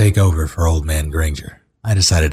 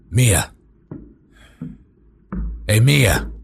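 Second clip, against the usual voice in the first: loud call to someone out of sight; raised voice summoning a partner; 12 semitones lower, 4 dB louder, slower than usual. Acted. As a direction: holler the name to fetch someone. Ben calls her from another room.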